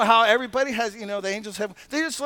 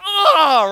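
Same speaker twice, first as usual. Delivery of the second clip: loud, high imitated gasp of "oh"; acted shout of amazement; awestruck cry; 6 semitones higher, 11 dB louder than usual. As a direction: cry out as if overwhelmed.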